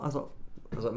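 usual speaking voice

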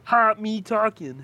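high, slow frog puppet voice; Kermit the Frog voice. Retold or imitated. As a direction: squeak it like a felt frog puppet. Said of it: "your best Kermit the Frog impression".

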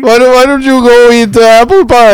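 mock Indian accent. Friend joining the bullies, mild mock Indian accent. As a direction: tease like a schoolyard bully in Indian accent. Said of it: "bullied in an Indian accent".

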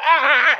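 high, loud comic wail; exaggerated yelp of pain; mock crying scream. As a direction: cry out as if being hit, hammed up. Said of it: "And then I started crying".

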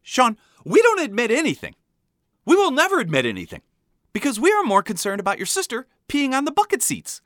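high-pitched, exasperated scolding; screaming mom. A narrator's reading. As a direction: mock-scream as a fed-up mother. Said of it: "suddenly screaming back at me".

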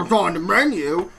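jowly mock complaint; hammed-up grumbling protest; blustering customer. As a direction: imitate a grumbling, blubbery customer. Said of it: "So he goes".

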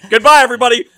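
loud, high farewell with whoosh; dramatic exit. Retold or imitated. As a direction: announce your exit and vanish. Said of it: "I would have just".

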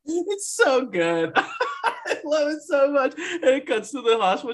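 normal talking voice

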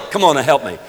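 ordinary talk

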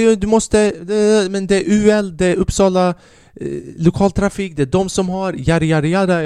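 mocking recital that slides into yada-yada; mock inspector. Mimics inspector reciting rules, trailing into nonsense.

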